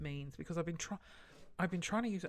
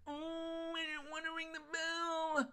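high, soft drawn-out whine; self-mocking high-pitched wail; mock whimpering; 10 semitones higher, 4 dB quieter, slower than usual. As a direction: whinge it like a reluctant child. Whiny self-quote about the bell.

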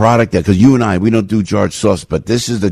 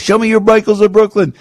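mock slogan; 13 semitones higher than usual.

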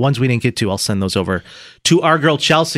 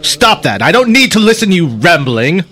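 put-on louder grumpy old miser; cranky Scrooge; 5 dB louder than usual. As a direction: scold like a stingy old boss. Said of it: "we did a scene from the film".

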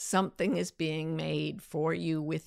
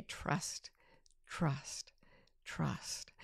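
breathy whispered chant of trust; barely voiced, like an inner voice murmuring; 4 semitones lower, 13 dB quieter, much slower than usual. Inner voice whispering to her, trust. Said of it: "something was saying to me".

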